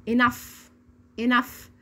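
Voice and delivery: "enough" closing on a hissed f; spaced model word "enough", clear f; final GH as F. Shown as a demonstration. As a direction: repeat "enough" as "inaf". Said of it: "زي صوت حرف الاف بالظبط".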